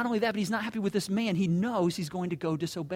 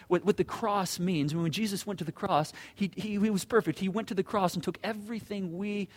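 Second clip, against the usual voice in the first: normal talking voice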